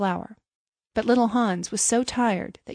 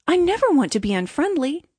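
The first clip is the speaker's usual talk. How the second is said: higher, earnest protest; pleading Hans; 6 semitones higher, 4 dB louder than usual. A narrator's reading. Hans protests he is friendly, higher and earnest. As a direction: protest as guileless little Hans.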